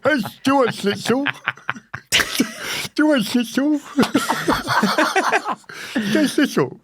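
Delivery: high, lisping mimicry of a friend with a brace; mocking an Invisalign lisp. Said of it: "It's hard to say with Invisalign".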